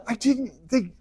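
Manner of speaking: squeaky guilty excuse-making; flustered Aaron. Retold or imitated. Aaron explains the golden calf to Moses, stammering guilty excuse. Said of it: "Aaron's like".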